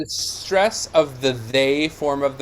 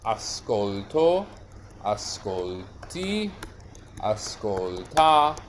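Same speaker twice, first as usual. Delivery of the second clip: slow syllable by syllable, softer and lower; sounding out conjugation; 4 semitones lower, 4 dB quieter, slower than usual. Shown as a demonstration. Teacher writing out verb forms for the class, slow recitation. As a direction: recite each form slowly, spaced apart. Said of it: "so if I do this, I get".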